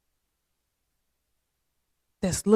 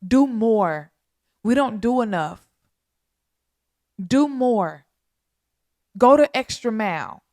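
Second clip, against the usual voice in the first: louder, rising preacher's call; fervent exhortation; 3 semitones higher, 6 dB louder than usual. Real, not acted. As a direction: push them to act, with full force.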